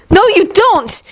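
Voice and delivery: high furious cry; yelled. Acted. Yuri yells at Victor, furious. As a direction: yell it, shaking with rage. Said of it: "he yelled, shaking his head furiously".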